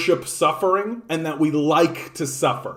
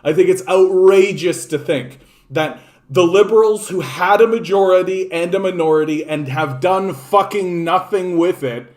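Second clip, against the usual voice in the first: ordinary talk, nothing marked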